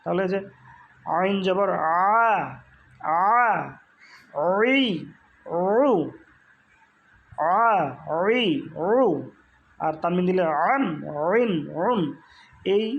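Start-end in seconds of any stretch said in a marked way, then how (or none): 1.2-8.9 slow, strained "আ, ঐ, ঔ" from mid-throat; squeezed throat vowels. Shown as a demonstration. Teacher drills ain with vowels, squeezed and long. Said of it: "আওয়াজকে চাপাইয়া উচ্চারণ করতে হয়". 10.7-12.0 "আন, ঐন, ঔন" pressed from mid-throat; squeezed throat nunation. Shown as a demonstration. Teacher adds final n to ain, strained and pressed. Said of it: "কণ্ঠনালীতে চাপ দিচ্ছেন".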